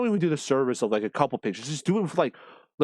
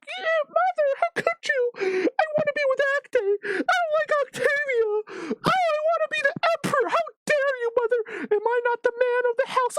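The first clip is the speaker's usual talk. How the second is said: much higher, sobbing whine of a spoilt son; whiny crying Nero. Retold or imitated. Nero whines tearfully at his mother, much higher. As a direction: play a snot-nosed boy crying at his mother. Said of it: "Like, crying, whimpering".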